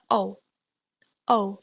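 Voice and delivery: O like goal without the L; O as in goal minus the L; pinyin ou. Shown as a demonstration. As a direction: round O, voice at the back. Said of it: "like the O in goal".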